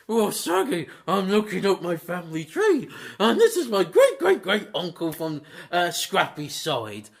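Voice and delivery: hammed-up Scooby, laughing through it; Scooby-Doo voice. Scooby telling Shaggy about his family tree, in a giggly cartoon dog voice.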